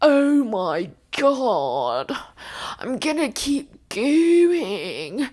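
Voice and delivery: slow, strained weary voice; faking tiredness. Retold or imitated. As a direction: push through exhaustion, dragging. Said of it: "And Cody would be like".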